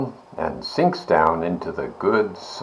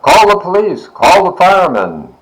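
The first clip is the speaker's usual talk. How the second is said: much higher, louder urgent shout; 10 semitones higher, 6 dB louder than usual. A narrator's reading. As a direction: call out for help. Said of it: "shouts the farmer".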